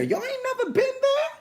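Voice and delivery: high-pitched disbelief, rising at the end; incredulous high question. Real, not acted. Preacher prodding the congregation for agreement, high incredulous question. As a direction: squeal the question at the crowd.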